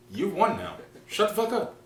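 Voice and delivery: high, loud fed-up plea; exasperated, squeaky-high outburst; mock exasperation. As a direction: concede loudly, pitch climbing. Acting out conceding to partner.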